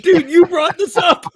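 very high, loud, slow, laughing; laughing exasperation. Retold or imitated. Host mocking Booker, squealing through laughter. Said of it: "I'm like".

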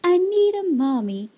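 put-on high, loud childlike wail; acted crying. A narrator's reading. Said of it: "he started to cry".

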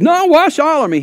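loud, high acted outburst; eager protest. Disciple pleads with Jesus to wash him, eager protest. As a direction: blurt it out high and loud, acting a character.

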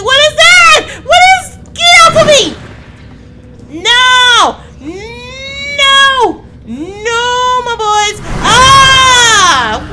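very high, very loud panicked screams; terrified screaming. Real, not acted. Attacked by the monster in the dark, terrified screaming. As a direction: scream for your life. Monster grabs player's character.

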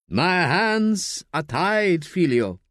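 slow, regretful priest voice; slow sorrowful priest. A narrator's reading. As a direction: let him down gently, very slowly. Priest answers gently.

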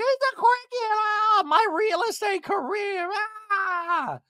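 high, wailing hammed-up shrieks; mock panicked scream. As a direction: imitate someone screaming at a bug. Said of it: "pulls out a cricket and screams".

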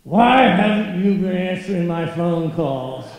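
loud, angry diva voice with too much Brooklyn. Retold or imitated. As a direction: play the furious star who demands answers. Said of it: "angry voice".

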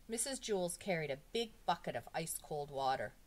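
ordinary delivery

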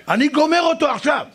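high-pitched mock threat; threatening big guy. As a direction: vow revenge as an enraged hothead. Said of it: "ככה הוא עושה לי". (איום זועם בקול גבוה)

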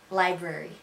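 Buffalo-accented "library". Shown as a demonstration. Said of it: "The Miami accent and the Buffalonian accent".

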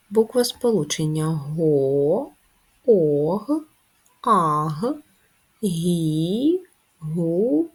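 each syllable said out loud and separately; syllable drill. Shown as a demonstration. Teacher dictating letter pairs for pupils to write. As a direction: call out each syllable clearly. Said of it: "teacher dictating letter syllables".

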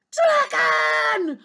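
high, loud princess shout; piercing child's yell; bellowing. Acted. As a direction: bellow to the dragon. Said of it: "bellowed the little princess".

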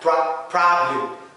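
"pro-" then "problem", slow with an open "a"-like o; open o, slowly. Shown as a demonstration. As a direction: drag the syllable to show the open o. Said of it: "ال O غالبًا ما يكون مفتوح". ("problem" ببطء و o مفتوحة)

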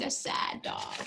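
low, soft, sad. Acted. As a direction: droop the voice down. Sad dog comment.

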